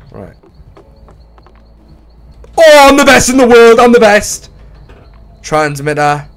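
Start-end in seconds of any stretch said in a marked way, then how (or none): 2.6-4.5 high, loud triumphant boast; I'm the best, shouted in glee; gloating brag. Real, not acted. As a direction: crow about your own skill. Bragging after clearing jump.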